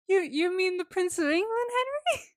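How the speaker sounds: high, rising disbelief; imitating a stunned mother, voice climbing; mock incredulous mother. Mother's stunned question, retold.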